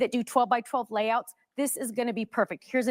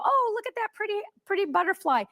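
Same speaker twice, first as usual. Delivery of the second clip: high, thrilled gasp of delight; delighted squeal; 9 semitones higher than usual. Real, not acted. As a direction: gush over it with rising pitch. Delighted at the butterfly.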